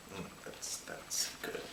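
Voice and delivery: breathy whispered aside; whispering. Acted. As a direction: whisper it as a satisfied sigh.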